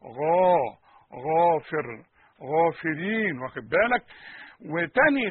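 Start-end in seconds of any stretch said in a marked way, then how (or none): 0.0-3.4 "غا، غافر، غافرين" with the غ fully heavy and deep before the long a; heavy gh demo. Shown as a demonstration. Quran teacher showing the heaviest degree of غ, emphatic demo. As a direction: push the غ to full heaviness in "غافر". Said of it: "أعلى درجات التفخيم".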